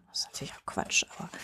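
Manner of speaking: murmured aside, the voice dropping to a whisper; half to herself, breathe it as a throwaway remark. Real, not acted. Host whispers an aside to the poet about his typical poem.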